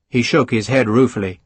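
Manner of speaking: ordinary delivery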